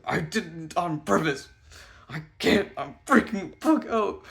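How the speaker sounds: high, loud, drawn-out panic; panicked outburst. Retold or imitated. Refusing to go talk to her, acted panic. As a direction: refuse in a slow, loud freak-out.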